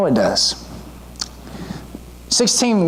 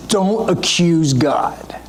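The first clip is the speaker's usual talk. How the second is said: slow hushed whisper; 3 semitones lower, much slower than usual. Retold or imitated. Preacher voicing Elihu warning Job, slow whisper. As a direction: breathe the warning slowly.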